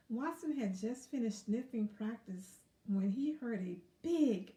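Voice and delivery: plain reading voice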